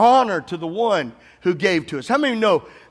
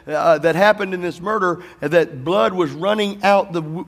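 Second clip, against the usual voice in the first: ordinary delivery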